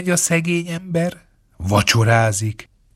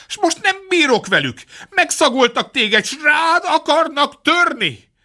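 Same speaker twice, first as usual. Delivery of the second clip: much higher, hammed-up fretful pleading; mock helpless; 9 semitones higher than usual. A narrator's reading. Poor man faking distress to scare the fox off, hammed-up whine. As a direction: play the helpless man, tongue in cheek.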